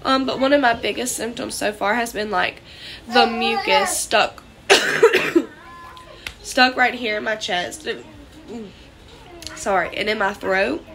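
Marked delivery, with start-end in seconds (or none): 4.7-5.5 a real chesty cough, mid-sentence. Real, not acted. Coughing fit while describing mucus.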